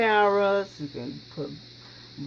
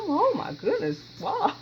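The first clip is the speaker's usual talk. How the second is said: much higher, hammed-up wow; mock amazement; 9 semitones higher than usual. Cook jokingly marvels at the history of her dish, hammed-up surprise. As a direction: overplay being impressed. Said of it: "I'm just kidding".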